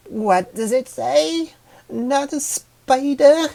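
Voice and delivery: very high, slow, puzzled flower voice; pondering flower. Acted. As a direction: mull it over, slowly, like a tiny doubtful flower. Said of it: "the yellow flower pondered".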